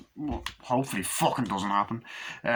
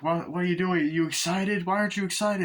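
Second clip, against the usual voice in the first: much higher, insistent eager questions; pushy excitement; 9 semitones higher than usual. Acted. Winston Duke pushing the family to be excited, high and eager. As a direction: badger them to get excited.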